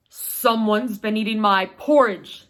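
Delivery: papa bear booms to his family, a touch gruff and loud. Acted. Papa bear to his family, a touch gruff and loud. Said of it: "said Papa Bear".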